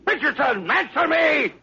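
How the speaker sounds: shouting. Acted. Captain shouting at silent gunnery crew, frantic. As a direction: bellow down to the gunners. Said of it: "It's no use to shout".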